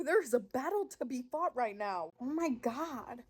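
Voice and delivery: normal talking voice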